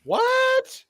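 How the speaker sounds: high shocked squeak; startled disbelief; surprised. Real, not acted. Shocked at grade increase.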